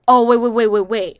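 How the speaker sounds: loud mock panic; freaking out. Guys caught out by sober woman, mock freak-out. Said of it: "the guys freak out".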